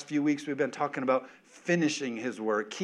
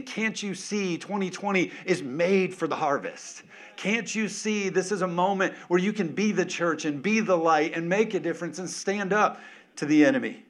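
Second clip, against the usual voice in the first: higher, pleading preacher's cry; impassioned preaching; 5 semitones higher, 3 dB louder than usual. Acted. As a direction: plead with them to see. Preaching Jesus' plea.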